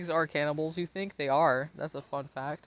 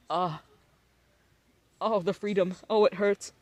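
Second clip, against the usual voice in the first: mock pain; 4 semitones higher, 5 dB louder than usual. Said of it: "It doesn't actually hurt".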